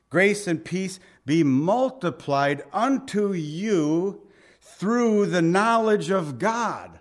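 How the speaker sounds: slow, measured verse proclamation; emphatic scripture reading. Real, not acted. Preacher reading the blessing to the congregation, slow and emphatic. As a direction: proclaim it slowly, stretching each phrase.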